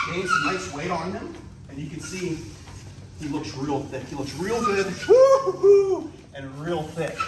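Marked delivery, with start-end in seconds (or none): 4.9-6.1 loud, high, drawn-out woo-hoo-hoo; a long, loud, high whoop of delight at the snake; excited whooping. Real, not acted. Thrilled by the cobra.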